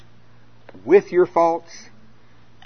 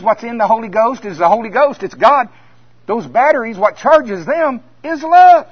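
louder and higher, building to a shout on love; climbing sermon shout; rising preacher shout; 5 semitones higher, 7 dB louder than usual. Real, not acted. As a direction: preach it up to full force. Preacher building to loud climax.

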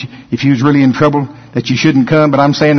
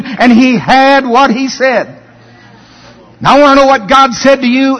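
preaching at full force; 9 semitones higher than usual. Acted. Preacher declaring Abraham's confession to the congregation, raised and forceful. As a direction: proclaim it with conviction.

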